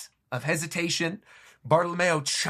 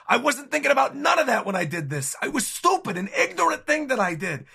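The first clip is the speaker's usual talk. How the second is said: higher, louder pleading as a sorry Bartolomeo; 7 semitones higher, 4 dB louder than usual. Acted. Bartolomeo apologizing to Luffy as he slams his head, pleading. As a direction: beg forgiveness in a raised voice.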